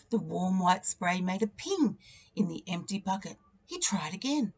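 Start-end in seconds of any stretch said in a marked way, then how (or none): none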